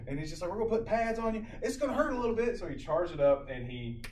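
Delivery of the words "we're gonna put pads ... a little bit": imitated medic in a raised pitch; fast-talking medic. Student medic warning patient it will hurt, fast-talking. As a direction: warn the patient in a high, put-on voice. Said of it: "He talks real fast".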